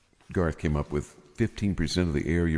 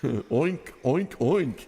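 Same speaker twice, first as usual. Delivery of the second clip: high mock oinking; pig oinks; 7 semitones higher, much slower than usual. Teasing about the joke's woman with mock oinks. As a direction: oink like a pig to mock her.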